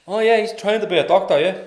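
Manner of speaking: dad boasting to the lads in the pub; proud dad boasting. Retold or imitated. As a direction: brag about your son to the pub.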